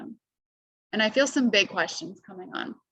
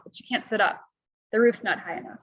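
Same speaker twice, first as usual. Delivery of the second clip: ordinary delivery, nothing marked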